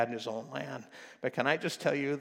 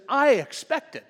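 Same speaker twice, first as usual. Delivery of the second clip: much higher and louder, offended; indignant outburst; 12 semitones higher, 10 dB louder, slower than usual. Retold or imitated. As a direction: burst out like a slighted commander. And then he says.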